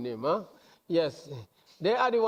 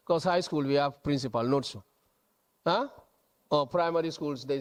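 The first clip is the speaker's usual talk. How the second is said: usual speaking voice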